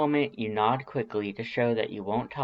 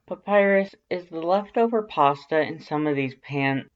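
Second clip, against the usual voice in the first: nervous subject change; 6 semitones higher, 5 dB louder than usual. A narrator's reading.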